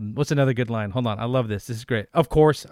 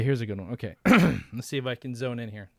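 ordinary talk

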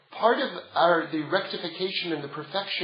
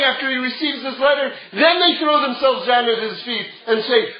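ordinary delivery

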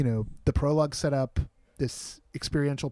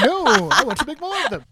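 higher, louder mock protest; imitating Graham; 12 semitones higher, 8 dB louder than usual. Host teasing Graham about his goal, mock high loud protest. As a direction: play a friend's eager objection, pitched way up and loud. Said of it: "Meanwhile, Graham's like".

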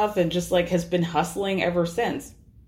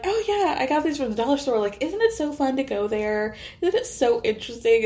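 mock bubbly; 6 semitones higher than usual. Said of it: "Jenna and Erin are like".

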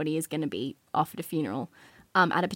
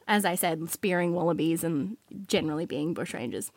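ordinary delivery

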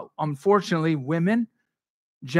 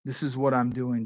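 ordinary talk

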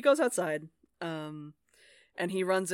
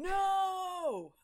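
mock shout; 12 semitones higher than usual. Friends voicing Gaspod to Laddie.

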